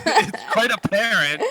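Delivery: real laughter through the line; laughing. Real, not acted. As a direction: laugh out the words. Laughing at losing mind.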